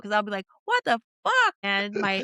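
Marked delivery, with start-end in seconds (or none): 0.7-1.5 loud, high burst of disbelief; outraged reaction. Retold or imitated. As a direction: re-enact an outburst that startles the dogs. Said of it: "freaking my dogs out".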